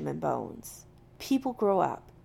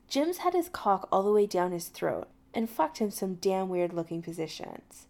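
ordinary delivery, nothing marked

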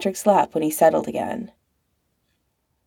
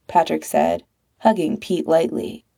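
usual speaking voice

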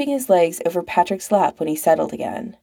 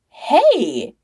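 realizing; 9 semitones higher, 3 dB louder than usual. Acted. Sudden realization.

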